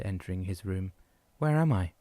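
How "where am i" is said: higher, insistent repeat; demanding repeat. A narrator's reading. Patient repeating question to nurse, insistent. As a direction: press the question hard. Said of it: "Dan repeated".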